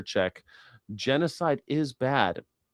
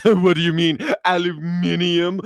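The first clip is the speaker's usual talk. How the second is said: higher, louder mock British "aluminium"; mock British scoff; 5 semitones higher, 4 dB louder than usual. Brits correcting an American, mock British accent. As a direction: scoff like a smug Brit.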